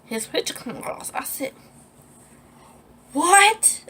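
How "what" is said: high, loud shriek of disbelief; retold scream. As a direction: re-enact a shocked, high-pitched yell. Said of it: "I just screamed".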